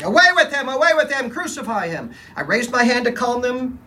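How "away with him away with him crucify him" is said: high, loud enraged shouting; hysterical rage. Acted. Crowd raging at the governor, high loud shouts. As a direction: roar it like a furious mob. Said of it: "They erupted in a hysterical rage".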